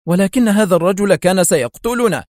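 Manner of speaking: defensive protest; objecting. A narrator's reading. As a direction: object earnestly, voice lifted. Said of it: "غمغم سمير معترضا".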